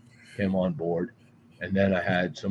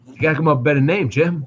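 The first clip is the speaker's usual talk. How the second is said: fast, higher and louder ribbing; teasing friend; 5 semitones higher, 6 dB louder, much faster than usual. Retold or imitated. Friend mocking his site name, fast and loud. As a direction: rattle it off, needling him.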